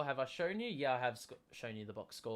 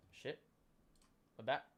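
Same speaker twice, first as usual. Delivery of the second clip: ordinary talk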